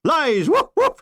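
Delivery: loud shouted denial, then high yelping woofs; an outraged officer who barks; barking colonel. Acted. Colonel denying he is a dog, with a loud shout and high yelps. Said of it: "he said".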